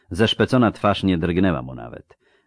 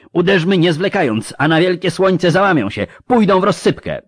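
high, loud, fervent urging; rousing battle shout; 12 semitones higher, 9 dB louder than usual. Acted. Officer urging the marshal into battle, excited shout. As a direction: press the commander to attack.

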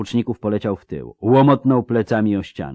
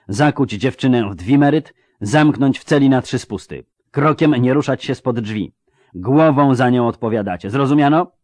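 loud, higher-pitched orders; commanding wizard; 5 semitones higher, 6 dB louder than usual. Acted. Wizard ordering servants to lock the girl up, commanding. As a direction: fire off orders, ending on a rising demand.

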